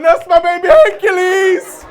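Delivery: high, loud proud shout; mum shouting proudly. Retold or imitated. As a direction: yell it like a proud mum out the window. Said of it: "My mum was outside the window going".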